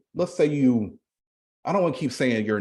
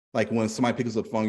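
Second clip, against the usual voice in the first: normal talking voice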